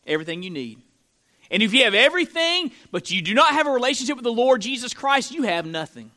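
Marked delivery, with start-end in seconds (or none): 1.5-6.1 higher, quickened pulpit delivery; fast, insistent sermon line; forceful preaching. Acted. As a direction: press the warning with raised voice.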